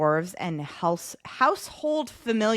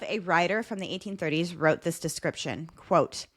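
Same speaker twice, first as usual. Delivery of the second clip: ordinary talk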